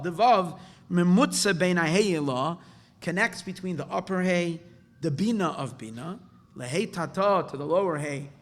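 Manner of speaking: loud, emphatic reading with running translation; teacher declaiming a Hebrew source; reading a text aloud. Real, not acted. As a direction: proclaim the text loudly, phrase by phrase.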